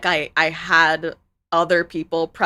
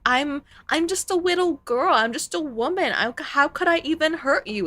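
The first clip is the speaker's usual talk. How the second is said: mock little girl voice; 8 semitones higher than usual. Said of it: "women also are like".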